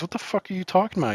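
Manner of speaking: high, fast incredulous outburst; baffled. Retold or imitated. Retold baffled reaction to slang.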